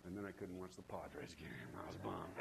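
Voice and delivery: soft confiding hush; whisper. Real, not acted. Preacher confessing disappointment to congregation, whispered.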